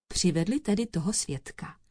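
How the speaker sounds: ordinary delivery, nothing marked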